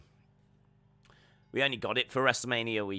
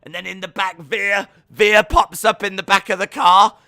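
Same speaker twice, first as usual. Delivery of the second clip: loud, high building reveal; excited storytelling; 7 semitones higher, 12 dB louder than usual. Real, not acted. Pitching Veer's ambush in the car, shouted excited telling. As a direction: shout the surprise twist.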